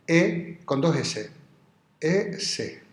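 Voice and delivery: slow dictation. Shown as a demonstration. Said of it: "el infinitivo del verbo ser es ese".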